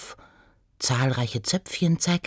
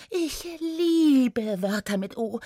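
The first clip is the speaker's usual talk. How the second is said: high gnome voice, savouring the words; babbling gnome; 7 semitones higher, slower than usual. Acted. Gnome to annoyed centaur, gushing prattle. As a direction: gush lightly, lingering on the vowels. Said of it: "plapperte Oda".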